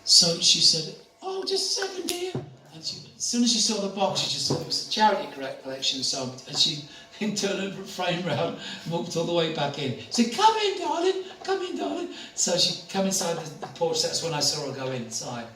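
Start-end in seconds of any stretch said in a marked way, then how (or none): 1.2-2.3 put-on much higher sweet old lady voice; high granny voice. Elderly lady voiced in story. 10.3-12.1 put-on much higher, fond old lady voice; high sweet granny invitation; old lady welcome. Elderly lady voiced in story.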